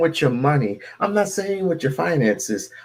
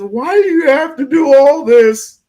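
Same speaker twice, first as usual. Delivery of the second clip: mock complaining voice; 8 semitones higher, 7 dB louder than usual.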